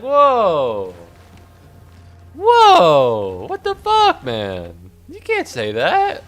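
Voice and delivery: high, squealed disbelief; loud, drawn-out falsetto protest; mock outrage. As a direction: squeal it like you're appalled on someone's behalf. Acting shocked reaction to an insult.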